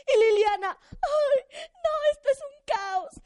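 high, loud frazzled wail; overwhelmed witch cry. Acted. Liliana the witch overwhelmed by chaos, high loud wail. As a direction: cry out like a witch at her limit.

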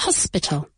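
"Hospital" with a breathed English h at the start; aspirated H. Shown as a demonstration. Teacher showing learners the aspirated h, "Hospital" starting on a breath. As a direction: let air out on the h of "Hospital". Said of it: "con una H aspirada".

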